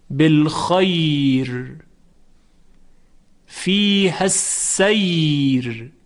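slow recited بالخیر, السیر, طیر, ضیر, stretched glide, thin final R; light R at pause. Shown as a demonstration. Teacher demonstrating waqf examples, long recited words with light final R. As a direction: recite each word long and stop on a light R.

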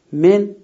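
من said high and loud, nun held silent; word من announced. Shown as a demonstration. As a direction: bring out من sharply on its own. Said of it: "یکی کلمهٔ من".

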